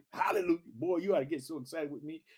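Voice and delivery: ordinary talk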